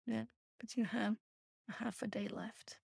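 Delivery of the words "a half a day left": breathy whispered aside. Real, not acted. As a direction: whisper it under your breath.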